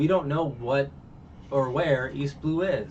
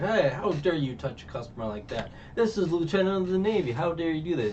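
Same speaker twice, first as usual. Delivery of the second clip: indignant officer; 7 semitones higher than usual. Retold or imitated. Navy lieutenant scolding Sanji.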